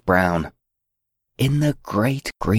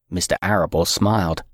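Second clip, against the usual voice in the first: ordinary delivery, nothing marked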